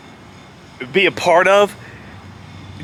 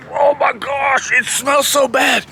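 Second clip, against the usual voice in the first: higher, drawn-out mock revulsion; disgusted; 7 semitones higher, slower than usual. Wrestlers stuck under the ring, mock disgusted groaning. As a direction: imitate someone overcome by a stench.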